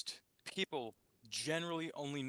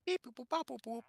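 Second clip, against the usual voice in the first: high beep-boop robot noises; 9 semitones higher than usual. Pretend. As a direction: chirp like a little robot. Imitates AI robots talking.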